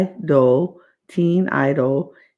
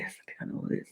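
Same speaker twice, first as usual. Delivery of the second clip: secretive whisper, much softer; 18 dB quieter, much faster than usual. Retold or imitated. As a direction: breathe it like a secret. Jane's confessional realisation, repeated.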